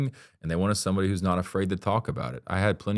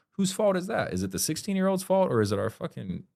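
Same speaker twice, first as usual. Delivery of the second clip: indignant rhetorical question; 12 semitones higher than usual. Real, not acted. Challenging the listener about who is to blame, indignant and high. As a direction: pitch jumps much higher in outrage.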